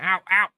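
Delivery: pained yelp. Real, not acted. Cat pounces on him.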